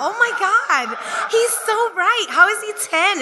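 very high, squealing mock-awed gush; hammed-up adoring fans gasping in falsetto; swooning guests. Guests swooning at the kid's scribble philosophy, squealing high mock awe.

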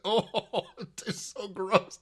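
laughing through disgust; half laugh, half groan. Real, not acted. Laughing at gross taste.

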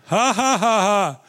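half-hearted put-on laughter; a laugh squeezed out on command; mock laugh retelling obedience; forced laugh. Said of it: "That was the best I could do".

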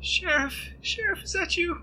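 high, far-off pleading woman's voice; a high thin cry for the sheriff; Tilda's frightened voice. Acted. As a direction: call out high and scared from the dark. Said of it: "you hear Tilda say".

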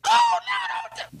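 very high wailing no; hammed-up panicked wail; mock terrified scream. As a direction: cry out like a man on a cross. Thieves screaming on crosses.

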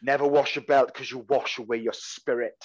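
normal talking voice

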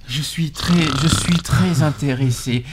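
slow, unenthusiastic delivery; limp candidate. Shown as a demonstration. Host plays a supposedly keen candidate, slow limp voice. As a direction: drag out the interest as if half asleep. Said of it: "s'il le dit d'une voix trop molle".

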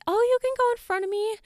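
high, quick mimicked offer; put-on high voice, tossed off quickly; imitating polite stranger. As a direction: mimic someone airily giving up their spot. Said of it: "they'll be like".

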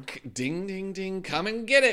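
rising to a loud shouted call; mock dinner call. Friend calling customers to the store, mock shout. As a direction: ring the bell and holler them in.

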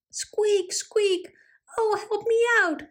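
very high little pig voice, pleading; squealing piglet cry. A narrator's reading. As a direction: squeak for help like a stuck piglet. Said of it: "cried Piggy".

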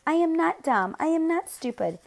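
put-on high-pitched, insistent child's denial; indignant protest. A narrator's reading. Small boy denying brother's insults, indignant high protest.

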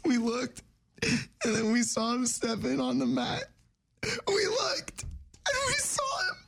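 high wailing sob through the words; crying. Acted. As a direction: blubber it out, crying hard. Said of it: "we'll do someone crying".

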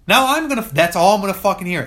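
loud, fast, high exasperated rant; exasperated outburst. Real, not acted. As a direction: blurt it out, fed up.

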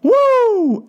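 high, held celebratory "woo"; imitated whoop. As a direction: let out a high whoop, mimicking his joy. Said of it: "he goes".

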